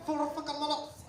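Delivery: high-pitched indignant exclamation; mock outburst. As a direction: squawk it as a put-on complaint. Imitating disgruntled audience members.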